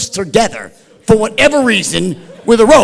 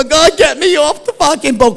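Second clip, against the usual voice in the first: mock crying; 7 semitones higher, 4 dB louder than usual.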